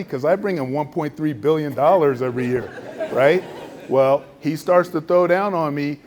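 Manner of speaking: normal talking voice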